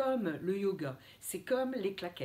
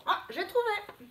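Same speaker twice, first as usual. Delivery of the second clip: mock excitement; 10 semitones higher than usual. Teacher pretends to hunt letters.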